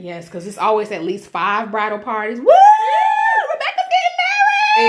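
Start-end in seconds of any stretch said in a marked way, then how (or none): 2.4-4.9 very high laughing party shout; party whoop. Retold or imitated. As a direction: whoop like a drunk bridesmaid. Mimicking a bridal party.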